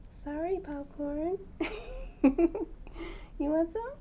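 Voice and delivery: high, loud laughing sorry; laughing apology. Real, not acted. Owner laughing an apology to her bird.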